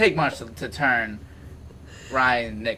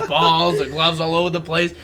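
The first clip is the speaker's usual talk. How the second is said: ordinary talk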